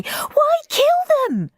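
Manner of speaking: very high pleading howl; howling boy. A narrator's reading. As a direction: wail the question. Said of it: "howled Henry".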